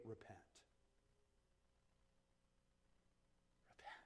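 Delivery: barely audible, low single word; grave, very soft pulpit quote; hushed command. Retold or imitated. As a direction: let the word fall almost to a whisper. Quoting Peter's answer in a hush.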